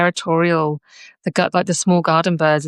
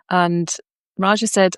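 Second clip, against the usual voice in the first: usual speaking voice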